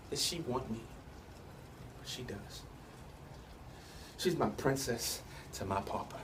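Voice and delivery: very soft, low, intimate confession; hushed tenderness. Acted. Man confessing she is his princess, very hushed and tender. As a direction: let the voice sink almost away, tenderly.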